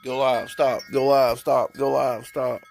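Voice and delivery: loud, choppy go live, stop on repeat; mimicking a glitching app. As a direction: bark out the app flickering on and off. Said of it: "It said".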